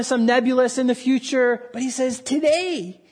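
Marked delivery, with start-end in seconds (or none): 2.2-3.0 "Today" pitched high and set apart; stress on TODAY. Retold or imitated. Preacher contrasting it with "one day", high emphatic stress on "Today". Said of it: "Jesus emphasizes something interesting".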